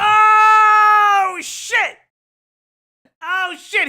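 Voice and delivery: loud, high "oh shit" of disbelief; stunned yell at the news; shocked shout. Real, not acted. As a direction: blurt it out in shock. Reacting to shocking reveal.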